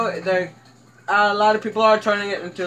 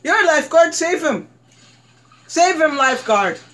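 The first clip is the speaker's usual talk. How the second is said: high, raised mock-frantic plea; urgent calling out; 6 semitones higher than usual. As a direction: yell at the lifeguard to act. Urging game lifeguard to rescue.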